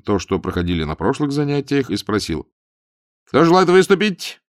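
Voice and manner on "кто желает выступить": officer voice. A narrator's reading. Officer asks class, raised voice.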